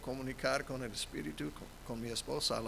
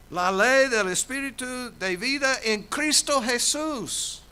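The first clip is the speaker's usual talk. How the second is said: loud, higher preaching cry; full-force preaching; 11 semitones higher, 10 dB louder than usual. Acted. Pastor proclaiming to the congregation, loud preaching. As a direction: preach it out to the whole church.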